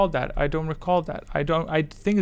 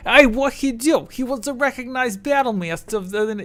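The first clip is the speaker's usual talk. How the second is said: high-pitched put-on American regional accent; exaggerated guess at someone's accent, high voice; 10 semitones higher than usual. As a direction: try out a stranger's accent, pitched way up. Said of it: "Let me try to get your accent".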